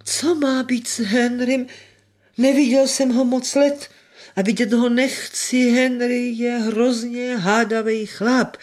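put-on high, slow querulous old voice; feeble old man. A narrator's reading. Housekeeper quotes her late master on Henry, feeble and querulous. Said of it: "takovým strašně slabým hlasem".

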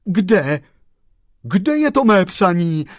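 loud, high, urgent question; agitated chauffeur's exclamation; crying out. A narrator's reading. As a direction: call out desperately for the letter. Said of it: "zvolal pan šofér Frantík".

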